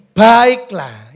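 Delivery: loud, rousing read-out; forceful demonstrated urging; exhortation. Shown as a demonstration. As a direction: proclaim it as an appeal. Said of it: "ini himbauan ya".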